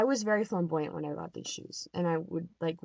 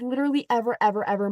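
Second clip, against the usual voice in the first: normal talking voice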